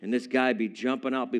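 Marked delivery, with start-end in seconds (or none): none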